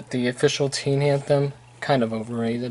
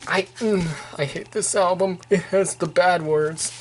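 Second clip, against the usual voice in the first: higher, lightly mock-offended prim voice; mock prudish; 4 semitones higher than usual. Reviewer jokingly scolds the album's swearing in mock prudish outrage. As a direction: play the scandalized prude, tongue in cheek. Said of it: "but seriously".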